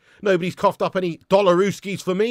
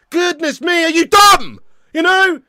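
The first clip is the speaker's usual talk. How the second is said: exasperated scorn; 10 semitones higher, 8 dB louder, slower than usual. Real, not acted. Mocking a commenter.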